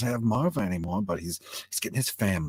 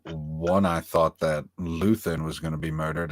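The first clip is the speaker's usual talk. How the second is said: ordinary talk, nothing marked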